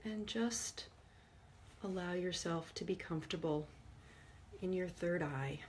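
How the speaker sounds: soft meditation voice with long pauses; guided meditation. Real, not acted. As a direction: guide listeners gently inward, hushed and unhurried. Leads a forgiveness meditation.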